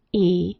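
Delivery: "E" as a held long ee vowel; long E sound. Shown as a demonstration. Teacher demonstrating the most common EA sound, long "E". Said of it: "It says long E".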